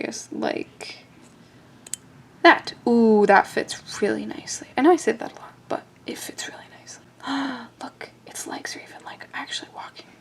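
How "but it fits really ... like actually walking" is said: breathless hushed whisper; awed whisper. Real, not acted. Maker marveling at the walking Tic Tac, hushed whisper. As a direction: whisper it in delight. Delighted whisper at tiny part.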